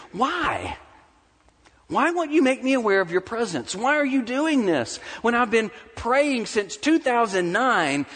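high, pleading cry of why; retold prayer in anguish; anguished questioning. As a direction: plead with God for a reason, voicing his fear. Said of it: "he wondered in the middle of all these fears".

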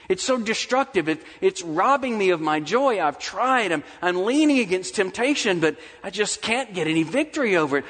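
high, urgent pleading that drops at the close; acted plea for deliverance; desperate prayer. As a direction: pour out a struggle to God. Acts out a struggler's prayer.

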